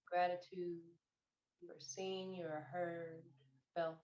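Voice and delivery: slow, soft meditation voice with long pauses; guided meditation. Real, not acted. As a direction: gently guide them into stillness, unhurried, phrase by phrase. Leads a meditation.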